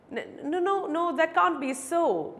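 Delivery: high, stammering denial; flustered mumble. A narrator's reading. Beggar caught lying by the advocate, flustered mumble. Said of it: "mumbled the beggar, taken aback".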